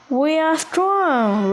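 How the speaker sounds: lightly voiced roaring crocodile. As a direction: boast it like a proud croc, slowly. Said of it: "roared the crocs".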